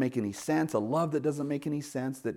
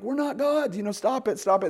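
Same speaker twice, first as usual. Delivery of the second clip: much higher, frantic "stop it"; panicked protest; 11 semitones higher, 4 dB louder than usual. Retold or imitated. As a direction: plead with the crowd in alarm. Paul and Barnabas panic.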